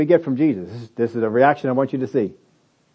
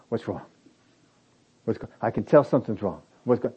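concerned; 5 semitones lower, 7 dB quieter than usual. Retold or imitated. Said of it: "if they start showing emotion".

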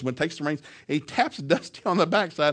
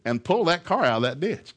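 ordinary delivery

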